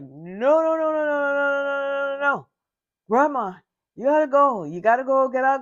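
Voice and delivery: put-on mortified teen yelling, high and loud; horrified shouting. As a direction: panic and shove grandma out of the room.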